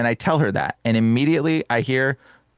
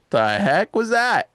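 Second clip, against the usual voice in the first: startled disbelief; 8 semitones higher, slower than usual. Retold or imitated.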